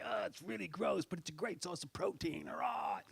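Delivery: soft, breathy British host, ending in a whispered cheer; hushed enthusiasm over eating bugs; Bear Grylls impression. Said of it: "He's like".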